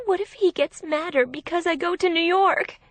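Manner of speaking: high, anxious, panicky question; panicked. A narrator's reading. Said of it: "She sounded panicked".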